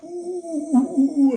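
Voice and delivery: high wincing ooh from the cousin; a sharp sympathetic ooh, way up high; pained ooh. Retold or imitated. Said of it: "My cousin was like".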